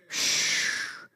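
soft unvoiced shush; a breathy shh; hush. Real, not acted. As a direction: quietly hush the room. Hush in sermon.

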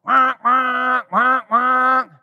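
playful honking noise; car horn imitation. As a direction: mimic a blaring horn.

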